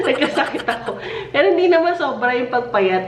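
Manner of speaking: amused, laughing speech. Real, not acted. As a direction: still giggling as you explain. Laughing after own joke.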